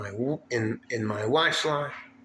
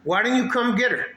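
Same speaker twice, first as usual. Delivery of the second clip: higher, louder angry snap back; angry retort; 10 semitones higher, 4 dB louder than usual. Retold or imitated. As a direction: fire it back at her, furious and high. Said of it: "and I'm like".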